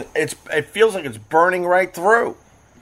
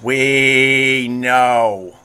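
long, drawn-out exasperated "we"; weary of being told. Real, not acted. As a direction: stretch it out, fed up. Fed up with unwanted fishing advice.

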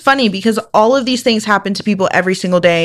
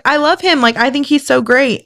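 gushing fangirl; 6 semitones higher than usual. Retold or imitated. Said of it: "but I was like".

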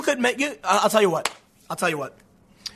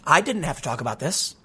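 usual speaking voice

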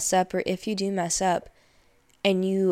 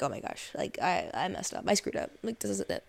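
a quiet imitation of inner doom; hushed panic; 8 dB quieter than usual. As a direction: mutter it like you've ruined everything.

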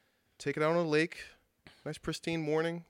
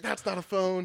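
retold frustration; 6 semitones higher than usual. Retells searching the lake for phone.

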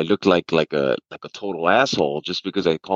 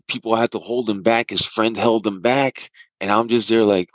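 usual speaking voice

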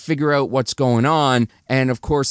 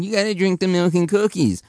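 high, fast mocking singsong; 7 semitones higher, faster than usual. [name] mocking the little girl on his way out, high and fast. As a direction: mimic the little girl to mock her. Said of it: "he kind of mocks her".

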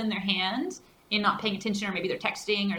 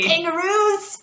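put-on high, loud, drawn-out Aussie accent; mock Australian accent; 9 semitones higher, 6 dB louder, slower than usual. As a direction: stretch it in a hammed-up Australian twang. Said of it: "He's Australian".

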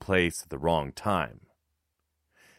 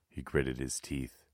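ordinary delivery, nothing marked

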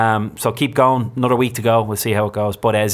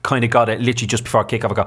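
ordinary talk, nothing marked

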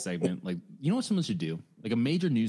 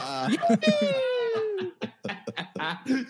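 high shrieking laugh. Real, not acted. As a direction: crack up hard. Laughing at the bit.

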